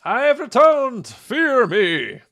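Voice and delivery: loud, high, slow mock villain. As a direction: boom it out like a returning villain. Mock villain return in game.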